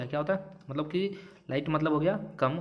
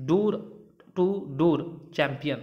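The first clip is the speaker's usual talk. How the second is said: slow dictation; much slower than usual. Shown as a demonstration. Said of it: "example phrase".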